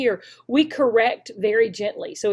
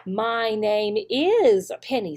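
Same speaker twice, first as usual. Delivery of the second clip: "My name is Penny" very slow with "is" stressed; slow word-by-word model; much slower than usual. Shown as a demonstration. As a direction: count out each word slowly, hitting "is". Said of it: "count the number of words".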